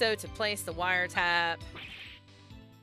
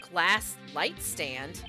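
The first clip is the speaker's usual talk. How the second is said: slow, deliberate; much slower than usual. Acted. Slow reading of receipt.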